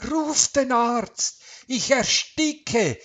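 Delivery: high, slow, desperate gasping plea; choking gasp. A narrator's reading. Suffocating wife begs husband for a doctor, gasping. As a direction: gasp it out high, fighting for air. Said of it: "keuchte sie nach Luft ringend".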